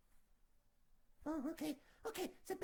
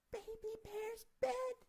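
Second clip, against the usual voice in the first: ordinary delivery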